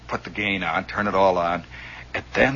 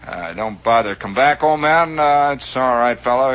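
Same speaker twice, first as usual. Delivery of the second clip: raised, droning radio-operator voice; hammed-up on-air brush-off, strung out with uhs; dismissive veteran ham; 5 semitones higher, 7 dB louder than usual. Famous ham telling off a newcomer, dismissive radio drone.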